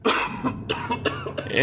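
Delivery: a harsh, airless coughing fit; violent coughing. Acted. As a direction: cough hard, choking on it. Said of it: "he started coughing violently".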